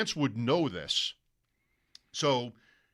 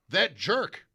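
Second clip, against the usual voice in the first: higher, louder teasing "That jerk"; mock indignant; 5 semitones higher, 5 dB louder than usual. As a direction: egg him on with fake outrage. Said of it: "egg him on".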